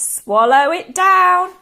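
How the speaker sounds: high, loud, drawn-out command; crying out. A narrator's reading. George spooning medicine into Grandma, loud drawn-out cry. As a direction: sing out the order, stretching each word. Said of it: "George cried out".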